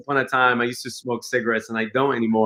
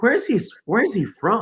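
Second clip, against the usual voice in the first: puzzled wondering; 9 semitones higher than usual. Retold or imitated. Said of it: "I'm like".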